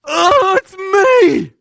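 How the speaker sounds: high, loud cry of realization; sudden realization. Retold or imitated. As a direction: gasp it out as it hits him. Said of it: "and he's like".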